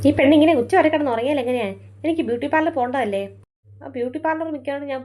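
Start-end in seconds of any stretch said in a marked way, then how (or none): 0.1-3.2 loud exasperated scolding; mother yelling at her lazy daughter. Acted. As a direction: nag her out of bed, loudly.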